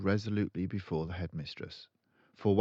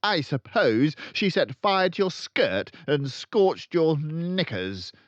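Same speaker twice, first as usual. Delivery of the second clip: loud, high sneering headmistress, slowing on the end; mocking bully headmistress; snorting Trunchbull; 11 semitones higher, 10 dB louder than usual. A narrator's reading. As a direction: sneer it out with contempt. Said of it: "Miss Trunchbull snorted".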